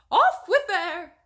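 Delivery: high and loud, slow furious order; furious queen. A narrator's reading. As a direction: scream the royal order.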